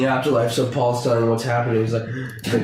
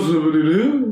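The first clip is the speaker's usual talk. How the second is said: [name] impression; 3 semitones higher than usual. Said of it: "And goes".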